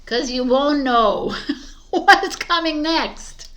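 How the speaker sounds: laughing through it, high and slow. Real, not acted. Reader telling Aquarius to trust the unknown, laughing.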